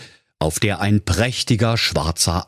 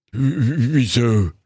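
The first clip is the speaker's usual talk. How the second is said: stammering surprise; 3 semitones higher than usual. Acted. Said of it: "fragte der falsche Graf ganz erstaunt".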